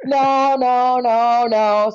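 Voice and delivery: a string of insistent, drawn-out noes; retold brawling. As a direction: act out arguing back in court. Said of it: "openly brawling in court".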